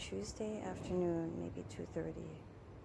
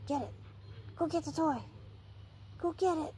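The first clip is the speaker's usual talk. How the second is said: urging a puppy; 8 semitones higher than usual. Real, not acted.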